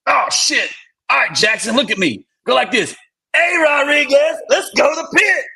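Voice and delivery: high bellowing drill instructor. Retold or imitated. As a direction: call out the order like a DI. Drill instructor voiced in story.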